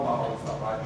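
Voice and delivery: ordinary talk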